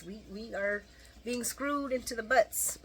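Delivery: ordinary talk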